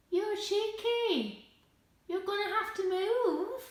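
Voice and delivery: high, slow mock scolding; teasing the dog. Real, not acted. Dog took her chair.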